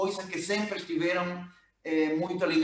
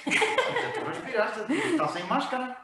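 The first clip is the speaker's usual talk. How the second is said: laughing; 10 semitones higher than usual. Real, not acted. Teasing colleague, laughing.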